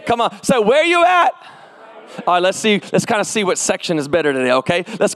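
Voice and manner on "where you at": loud, high holler to the crowd; rallying pulpit yell, pitch way up; call-and-response shout. Real, not acted. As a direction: belt it out for their answer. Calling out to the congregation.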